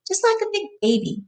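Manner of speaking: high cooing tease; cooing baby talk. Acted. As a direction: coo it like talking to a baby. Teasing Lenny like a baby.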